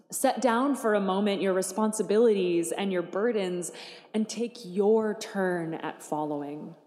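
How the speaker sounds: ordinary delivery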